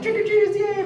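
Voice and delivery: high mock-excited cheer; gleeful greeting. Retold joy at trick-or-treaters.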